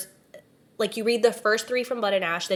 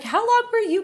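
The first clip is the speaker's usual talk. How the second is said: high quoted question; 13 semitones higher than usual. Retold or imitated. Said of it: "'cause I was like".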